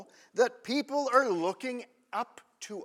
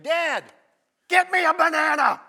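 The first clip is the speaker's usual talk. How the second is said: put-on higher and louder, a child barking an order; bossy kid demand; 5 semitones higher, 9 dB louder than usual. Preacher imitating his kid ordering him to fetch a banana, bossy and loud. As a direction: play the entitled kid ordering Dad around.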